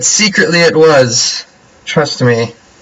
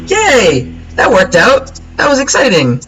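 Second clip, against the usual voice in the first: higher, brighter burst of real joy; real delight at winning; excited cheer; 10 semitones higher, slower than usual. Real, not acted. Winning the game.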